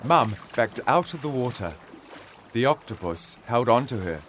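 usual speaking voice

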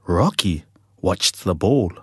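usual speaking voice

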